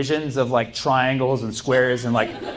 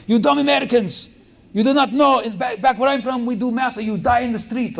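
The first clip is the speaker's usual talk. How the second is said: Ukrainian professor yelling; 10 semitones higher than usual. Retold or imitated. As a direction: rant like the intense professor. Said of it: "He would always say that".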